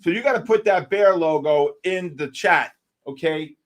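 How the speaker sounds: ordinary talk, nothing marked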